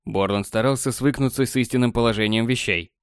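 ordinary delivery, nothing marked

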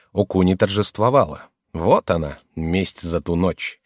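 ordinary delivery

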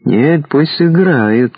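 slow, stubborn, drawn-out demand; stubborn insisting. Acted. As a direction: dig in and insist slowly. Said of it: "заупрямился вожак".

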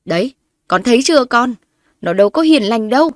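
high, loud shrill complaint; yelling. A narrator's reading. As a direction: yell to the son. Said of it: "lại gào lên".

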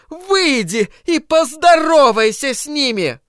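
terrify the crowd with visions of monsters; doom-preaching zealot. Acted.

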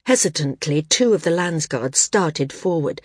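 ordinary delivery, nothing marked

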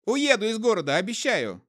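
a trader promising to leave town; terrified promise. A narrator's reading. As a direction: promise desperately as the voice breaks. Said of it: "голосок перешёл на хрип".

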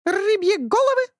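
high eager squawk; hopeful parrot question; bird squawk. A narrator's reading. (птичий вопрос)

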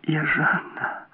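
high, faint, halting whisper; trembling-on-the-brink confession, hushed; barely whispered. A narrator's reading. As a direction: force it out softly through numb lips. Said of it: "едва прошептала она онемевшими губами".

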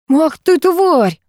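high, slow, drawn-out curse; angry curse. A narrator's reading. Gena bitten by the puppy, cursing in pain. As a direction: yelp it out in pain and rage.

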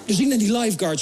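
ordinary delivery

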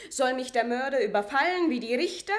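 laut, bitter anklagend; empörte Frage. Acted. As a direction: ihm die Frage entgegenschleudern. Maria fährt Paulet empört an. (Maria fragt Paulet laut und empört)

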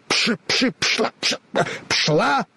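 halting, slow stammered shoo; a scarecrow struggling to get words out; mild mock shout; stumbling shoo. A narrator's reading. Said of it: "закричал я".

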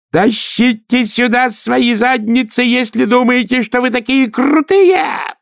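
high, slow, screaming bellow. Acted. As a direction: scream the challenge at the crowd. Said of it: "завопил он не своим голосом".

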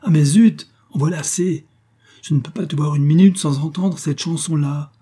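fed-up angry snap, easing slightly; violent exasperation. A narrator's reading. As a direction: explode with impatience at a nagging lover. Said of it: "Il frappa du pied, et violemment".

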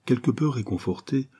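usual speaking voice

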